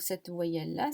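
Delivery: ordinary talk, nothing marked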